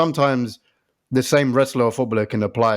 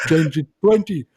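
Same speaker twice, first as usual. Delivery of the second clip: slow giant voice; 3 semitones higher than usual. Pretend. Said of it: "and you go".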